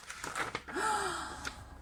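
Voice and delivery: sharp high gasp of surprise; a sudden caught breath, shocked. Acted. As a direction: Missus Dragon's startled gasp. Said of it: "Missus Dragon gasped".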